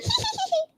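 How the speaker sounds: very high imitated cackle; mock giggle. Reactor copying her laugh after the accusation, mocking. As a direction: fake a squeaky, giddy laugh. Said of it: "this is her response".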